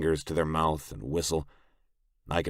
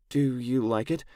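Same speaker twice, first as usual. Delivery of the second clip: put-on high girlish voice, asking for approval; hopeful girl; 8 semitones higher than usual. A narrator's reading. Sarah asking him about the dress, hopeful girl. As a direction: lift it into a higher young woman's question.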